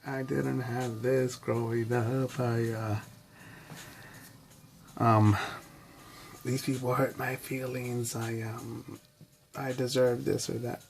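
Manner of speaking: slow, whiny self-pity, close to tears; mock self-pity. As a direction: play the victim, dragging the words. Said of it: "I could break down and cry and say".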